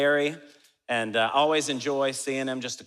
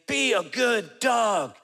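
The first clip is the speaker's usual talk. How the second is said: high, loud, drawn-out scolding; exaggerated stretched-out command; mock dog-owner shout; 7 semitones higher, 3 dB louder, much slower than usual. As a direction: yell slowly at the dog, hammed up. Man yanking his dog, quoted.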